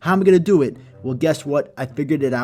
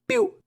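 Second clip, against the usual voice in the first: mouth gunshot. Acted. Gunshot sound.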